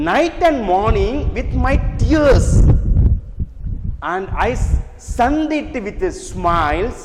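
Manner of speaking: slow, lifted reading of the stanza; poem recitation. Shown as a demonstration. Teacher reading the stanza aloud to students, slow and lifted. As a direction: recite it slowly with feeling.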